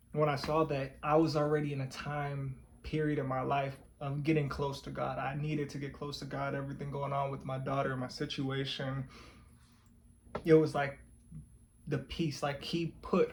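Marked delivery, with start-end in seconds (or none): none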